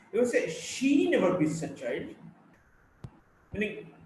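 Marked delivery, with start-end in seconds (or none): 0.5-2.2 "She" singled out, as opposed to someone else; stress on SHE. Shown as a demonstration. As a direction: lean hard and long on "She", rest falls away. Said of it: "If the stress is on she".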